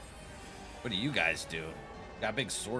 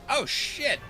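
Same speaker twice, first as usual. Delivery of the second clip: startled; 8 dB louder than usual. Real, not acted. Grabbed by enemy in game.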